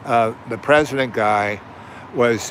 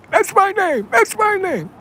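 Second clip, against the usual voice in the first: shrill, much higher mocking whine; mocking the teacher; 3 dB louder than usual. The offended teacher protesting his name, in a shrill mock whine. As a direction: whine it like an offended man-child.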